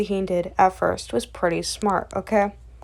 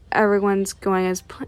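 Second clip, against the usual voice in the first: usual speaking voice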